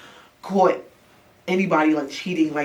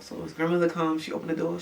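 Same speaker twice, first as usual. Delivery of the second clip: ordinary talk, nothing marked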